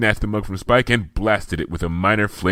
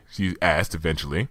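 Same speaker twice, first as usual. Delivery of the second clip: plain reading voice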